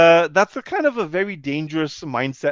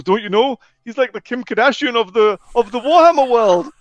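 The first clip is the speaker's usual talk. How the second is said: higher, louder, building to a big finish; starstruck gushing; 8 semitones higher, 9 dB louder than usual. Retold or imitated. Fan explaining husband's fame to the wife, gushing. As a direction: retell it as an amazed fan proclaiming fame. Fan gushes about the host.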